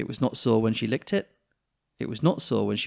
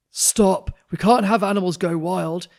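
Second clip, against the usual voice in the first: loud stop; 8 semitones higher, 11 dB louder than usual. Acted. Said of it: "said the vet".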